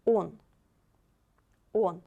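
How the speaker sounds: Он with a full round "oh"; stressed O. Shown as a demonstration. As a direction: model Он, the O kept as O. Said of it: "O we read like O".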